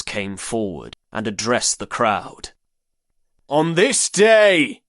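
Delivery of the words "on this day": loud commanding. A narrator's reading. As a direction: announce it loud and commanding to the crowd. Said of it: "he said in a loud, commanding tone".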